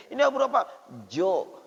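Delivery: ordinary delivery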